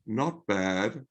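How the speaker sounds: low, soft, glum; imitating a glum Australian reply; mock miserable. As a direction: sound grudging and miserable. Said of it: "What a bloody miserable answer".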